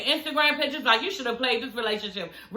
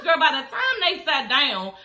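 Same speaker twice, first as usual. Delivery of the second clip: ordinary talk, nothing marked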